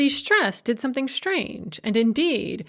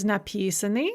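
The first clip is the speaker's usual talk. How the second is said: написаны stressed on the second syllable, пи; shifted stress. Shown as a demonstration. Teacher showing the stress shift, пи stressed in написаны. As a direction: move the stress of написаны back onto пи. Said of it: "the stress shifts to the front".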